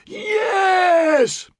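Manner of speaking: very high screamed crowd yell; bellowing. A narrator's reading. Thirty thousand answering the call, bellowed scream. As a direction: scream back with the whole army. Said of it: "bellowed thirty thousand".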